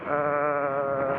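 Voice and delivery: usual speaking voice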